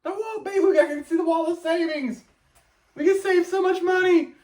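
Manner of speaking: a giddy bargain hunter begging his partner; mock giddy excitement. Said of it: "I was like".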